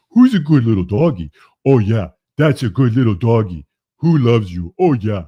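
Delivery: put-on high, loud baby talk to a dog. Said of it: "in her best little baby voice".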